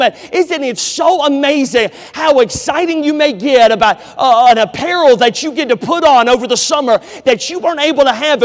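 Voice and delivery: loud driving sermon push; full-force preaching. Acted. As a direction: preach it hard to the chapel.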